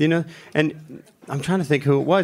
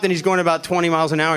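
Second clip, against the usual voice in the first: ordinary talk, nothing marked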